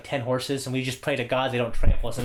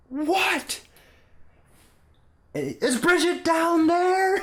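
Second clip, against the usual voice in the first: yelling across; 4 dB louder, much slower than usual. Retold or imitated. Shouted exchange.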